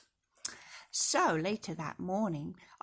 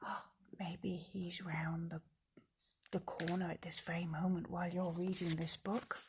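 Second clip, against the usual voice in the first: put-on hushed, secretive whisper to the child; spooky whisper; 3 semitones lower, 7 dB quieter than usual. Spooky warning to the listener.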